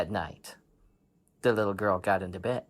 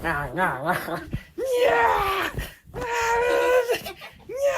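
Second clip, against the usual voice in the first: put-on girl's cries. Girl struggles with blanket.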